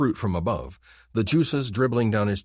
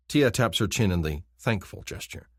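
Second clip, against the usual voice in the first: ordinary delivery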